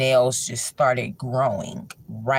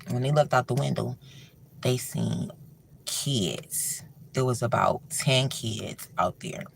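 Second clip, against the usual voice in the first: softer, hushed ghost-story telling; spooky storytelling; 4 semitones lower, 4 dB quieter than usual. A narrator's reading. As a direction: draw them in like a campfire tale.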